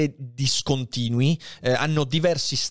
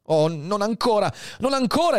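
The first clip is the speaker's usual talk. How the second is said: higher pleading cry; desperate exclaim; 9 semitones higher than usual. Acted. Edmond pleads with dying Faria, desperate. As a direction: beg him not to go yet. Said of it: "esclamò l'altro".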